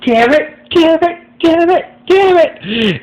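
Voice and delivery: high-pitched, wailing frustrated curse; frustrated shrieking. Acted. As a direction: shriek it in helpless frustration, almost crying. John beating chair in frustration.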